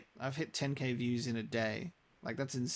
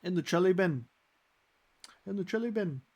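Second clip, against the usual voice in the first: put-on high, loud New Zealand accent; 7 semitones higher, 6 dB louder, faster than usual. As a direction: call out "In the chili bin" in a Kiwi accent. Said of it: "that's what they call an esky".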